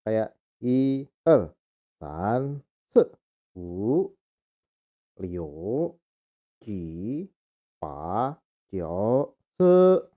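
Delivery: counting one to ten in Mandarin, each number apart; careful counting in Mandarin. Shown as a demonstration. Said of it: "Kita diajarin angka".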